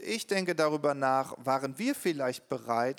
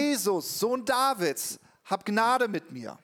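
crying out; 6 semitones higher than usual. Retold or imitated. Blind man crying out to Jesus for mercy. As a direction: call out to Jesus, pleading. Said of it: "formte sich dieser Ruf".

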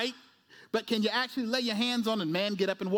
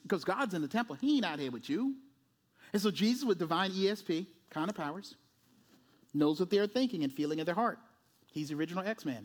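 ordinary talk, nothing marked

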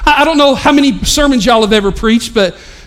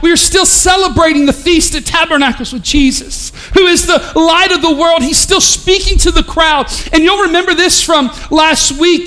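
raised, ringing preacher voice; preaching; 5 semitones higher than usual. Real, not acted. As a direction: preach it out to the whole room. Preaching full force.

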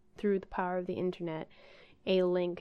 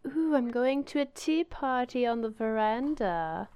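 posh tea party lady; 5 semitones higher, 4 dB louder than usual. Retold or imitated.

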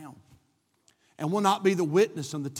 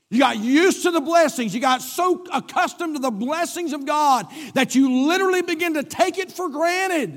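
raised pitch, building to a high peak; impassioned preaching; 5 semitones higher, 5 dB louder than usual. Real, not acted. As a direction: drive the point home to the congregation. Preaches at full force.

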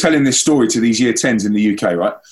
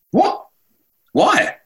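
much higher, incredulous what and why; shocked disbelief. Retold or imitated. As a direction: react as if you can't believe it. Shocked reaction to teacher's ban.

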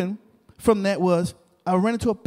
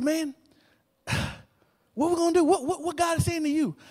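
high, lightly voiced questioning; imitating puzzled friend; 7 semitones higher, 4 dB quieter than usual. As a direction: mimic a friend asking in a higher voice. Prophet friend anxiously asks what next.

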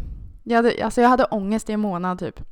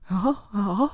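sleepy mumble. Retold or imitated. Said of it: "skittrött och var typ fortfarande full".

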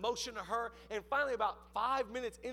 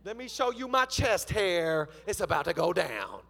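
suddenly tough; 8 dB louder than usual. Retold or imitated. Preacher turning on the phone scammer, loud mock tough talk. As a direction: square up with booming, hammed-up bravado. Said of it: "my whole tone changed right there".

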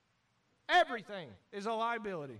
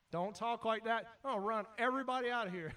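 ordinary talk

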